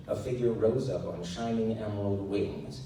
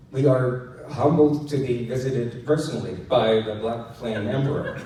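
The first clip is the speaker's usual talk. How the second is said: stiff and formal; 3 semitones higher, 9 dB louder than usual. A narrator's reading. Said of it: "Lyndon said formally and too stiffly".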